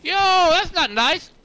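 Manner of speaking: high, loud and slow scolding; drawn-out reproach to the shooter; loud mock-hurt complaint; indignant call. Real, not acted.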